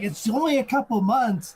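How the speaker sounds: high-pitched pleading imitation; mock protest. Underage girl arguing for a beer, mock protest. Acting out her protest about age.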